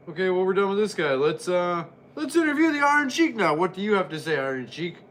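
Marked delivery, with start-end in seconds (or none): none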